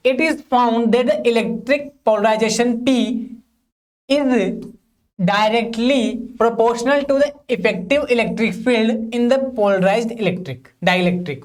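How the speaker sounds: slow, even reading for students to copy; slow dictation. Shown as a demonstration. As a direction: dictate each word slowly so they can write. Dictates the next definition. (धीमे-धीमे notes लिखवाना)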